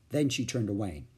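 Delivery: plain reading voice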